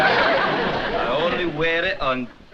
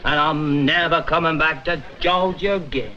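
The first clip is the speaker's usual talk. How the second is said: put-on Southern accent. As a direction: deliver a parting line as a drawling Southerner. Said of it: "try it with the stage moves".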